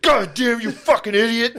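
high-pitched mock outrage; imitating a furious, startled outburst, voice way up; imitated spazzing out. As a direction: explode like someone who just got spooked. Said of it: "he fucking spazzed".

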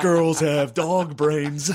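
loud, slow, drawn-out declaration; mock onstage rant. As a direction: stretch every word out loud and high, imitating a stage rant. Repeats his onstage rant.

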